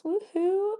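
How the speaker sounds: high playful woohoo; little whoop. Real, not acted. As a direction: celebrate the halfway mark. Twenty weeks milestone.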